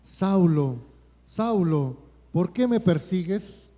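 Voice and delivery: loud, slow calling out "Saulo, Saulo"; the Lord's voice calling Saul, loud and grave; voice from heaven. Acted. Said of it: "oyó una voz que le decía".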